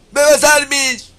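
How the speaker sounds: high, loud, drawn-out fake crying on "¡Bebas armies!"; hammed-up sobbing cry of "¡Bebas armies!"; mock wailing. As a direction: shriek "¡Bebas armies!" like a crybaby, slowly. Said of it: "Eso deben gritar".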